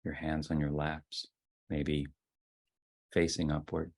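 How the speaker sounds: soft, unhurried voice with long silences; guided meditation. Real, not acted. Leader settling the group into ten minutes of sitting, guided meditation. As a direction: ease them gently into stillness, phrase by phrase.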